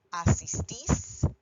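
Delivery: asistís said slowly, stress on the final ís; asistís with the accent clearly on the final syllable; slow stressed ending. Shown as a demonstration. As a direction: stretch asistís and lean on the last syllable. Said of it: "imos and is".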